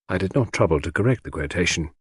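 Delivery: ordinary delivery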